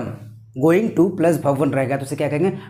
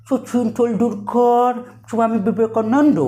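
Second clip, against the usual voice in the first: put-on higher, mangled American-accented Indian names; Donald Trump; 5 semitones higher, slower than usual. Teacher imitating Trump naming Indians on his visit, mock American drawl. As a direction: garble the names with a twisted mouth. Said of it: "Donald Trump जब India आया था".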